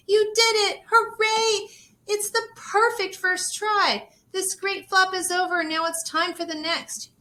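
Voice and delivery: high, loud, overjoyed cheer; joyful cheering. Acted. Great aunt celebrating Rosie's first flop, high loud cheering. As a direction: cheer like she just flew.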